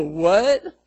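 loud, laughing what; shocked burst with a laugh; mock shocked what. Preacher voicing the congregation's shock, loud and laughing.

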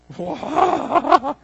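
high, loud wobbling "whoa"; hammed-up shaken cry; mock earthquake wail. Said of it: "it was like an earthquake".

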